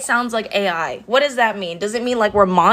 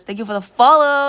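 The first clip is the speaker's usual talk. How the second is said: put-on Valley girl mode; 5 semitones higher, 4 dB louder than usual. Shown as a demonstration. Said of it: "I go like this".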